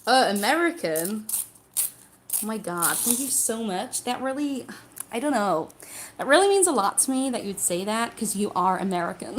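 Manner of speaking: gushing put-on American accent. Shown as a demonstration. Streamer thanking an American viewer, put-on American accent. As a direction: play a grateful American, lively tune. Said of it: "everybody that does American".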